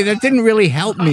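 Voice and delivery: high, pleading, half-laughing complaint; exasperated protest. Retold or imitated. Retelling the call to the club guy, amused exasperated protest. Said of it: "I love how you say that".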